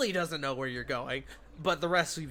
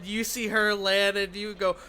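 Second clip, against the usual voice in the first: ordinary talk, nothing marked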